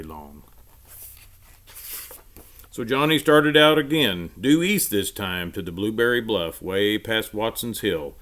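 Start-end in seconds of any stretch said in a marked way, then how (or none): none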